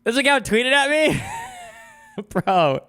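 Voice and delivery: high, loud, disbelieving yelps; can't believe what he's seeing on screen; real startled shouting with laughs; shocked outburst. Real, not acted. Shocked account tweeted at him.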